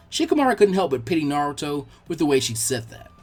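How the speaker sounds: plain reading voice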